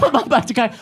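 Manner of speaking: lightly mocked child insisting; insistent child. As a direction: repeat it like a stubborn kid. Quarrel replayed again.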